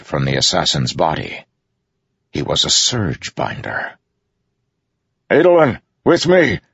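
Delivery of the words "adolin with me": loud, high command; shouted. A narrator's reading. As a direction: shout the order urgently to a fighter. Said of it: "Dalinar shouted".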